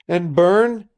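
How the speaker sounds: high, loud anguished cry; a sudden loud plea. Acted. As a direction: cry it out in distress. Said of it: "she cried out".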